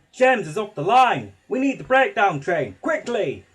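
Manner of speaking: loud urgent call; shouting. A narrator's reading. Man running up shouting for the breakdown train. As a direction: shout the alarm fast. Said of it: "he shouted".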